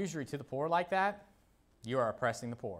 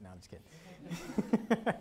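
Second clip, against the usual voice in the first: slow, laughing it off; 4 semitones higher, 4 dB quieter, much slower than usual. Real, not acted. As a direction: chuckle through the words. Jokes after punching remark.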